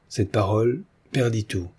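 ordinary delivery, nothing marked

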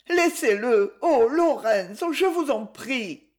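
put-on high, slow girlish plea; pleading. A narrator's reading. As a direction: beg him to stop, voice lifted. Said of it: "Hélène supplia". (supplication aiguë et lente d'Hélène)

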